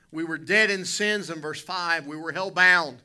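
loud, rising pulpit cry; preaching at full force. Real, not acted. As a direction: hammer it home to the congregation. Preacher builds to a climax.

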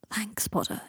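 soft, raspy voice after coughing. A narrator's reading. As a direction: rasp it out quietly, spent from a coughing fit. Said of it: "he said in a raspy voice".

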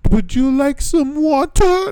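very high, slow singsong offer; exaggerated, stretched-out squeaky phrase; imitated students. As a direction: mimic students chanting a strange question. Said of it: "trying to say, like, Japanese stuff".

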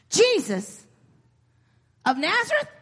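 acted amazement, pitch climbing to a high question; astonished question. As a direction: ask it in rising, hopeful disbelief. Blind man reacts to the news.

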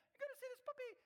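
high, loud burst of puppy excitement; excited grandkid. Retold or imitated. Grandkid showing off a new puppy, excited. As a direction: imitate a child bursting to show off the puppy. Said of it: "They were so excited".

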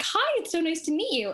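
light, high meet-and-greet voice; friendly dog greeting. Retold or imitated. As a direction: voice a polite, happy dog saying hello. Voices dog greeting roommate gently.

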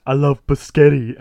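slow, loud dopey voice; dopey brother. Acted. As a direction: say it slowly like a dim brother. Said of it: "like, the dopey brother".

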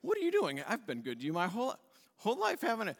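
high, squeaky, wounded donkey voice; donkey protesting to Balaam, high and plaintive; talking donkey. Acted. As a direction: play the hurt donkey pleading with its rider. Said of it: "Donkey says".

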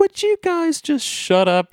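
fed up with the movie characters, high and drawn out; exasperated. Real, not acted. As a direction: groan it out in disbelief. Frustrated at characters.

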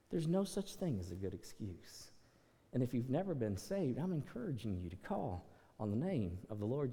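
low, soft pastoral invitation; hushed altar-call plea. Acted. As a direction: pull them in gently, almost confiding.

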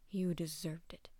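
low, slow sneer. Acted. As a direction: sneer it out coldly. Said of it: "the old man sneered".

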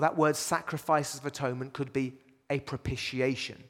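ordinary talk

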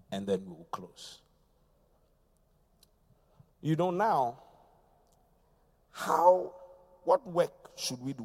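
ordinary talk

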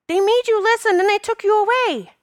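very high child voice imitation; realizing little girl. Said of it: "question look on her face".

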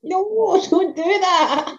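high, slowed-down pleading no; mock horrified plea. Telling a friend about clients ripping out toilets, mock horrified. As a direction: act out begging them not to rip out the toilet. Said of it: "And I'm like".

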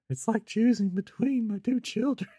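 very high, hammed-up wail; mock anguished plea. Husband told by wife to pick one, mock anguished. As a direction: plead in an exaggerated high voice.

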